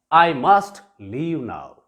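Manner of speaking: "must" leaned on, slow and deliberate; I must leave now said slowly with weight on "must"; insisting to yourself, slow; firm must, slowly. Shown as a demonstration. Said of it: "I insist, insist myself".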